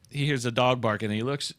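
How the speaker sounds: normal talking voice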